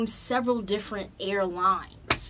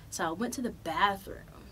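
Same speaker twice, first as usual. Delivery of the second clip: normal talking voice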